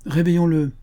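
rally them to wake him; jovial call. A narrator's reading. Player calls to wake him.

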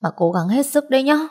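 high, pleading voice; high-pitched, imploring granddaughter; pleading with grandpa. Acted.

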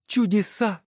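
high, scornful woman's voice; wife's voice. Acted. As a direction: sneer at each title as you sort them. Wife marvels. (высокий насмешливый голос жены)

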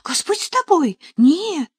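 high, emphatic exclamation; loud, earnest protest; startled denial. A narrator's reading. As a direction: deny it at once, pitch leaping. Надежа hotly denies the hurt.

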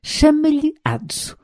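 measured and decided; firm. A narrator's reading. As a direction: settle the matter firmly, without raising volume. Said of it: "disse com firmeza".

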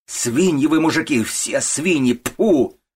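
high, loud abuse; furious shouting. A narrator's reading. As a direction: yell insults and spit out the last word. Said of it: "она снова закричала". (яростный крик с презрением)

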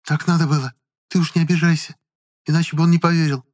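high-pitched, explaining herself; woman's voice. A narrator's reading. Woman to boy she let down, explaining. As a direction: justify it in a high voice.

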